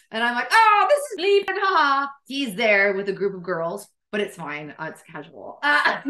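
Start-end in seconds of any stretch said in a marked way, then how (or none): 0.5-2.1 high, loud, over-bright social voice; hammed-up panicky politeness; flustered introduction. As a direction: introduce them with forced cheer and a fake laugh.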